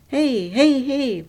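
loud, high cry for attention; shouting. A narrator's reading. Station clerk yelling at the car tearing out, shouting. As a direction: yell to stop the thief. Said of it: "she shouted".

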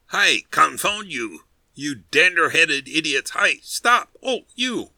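furious yell. A narrator's reading. Boatman shrieking at his chattering towers, furious yell. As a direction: scream at them to stop in a rage.

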